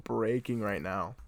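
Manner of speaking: ordinary talk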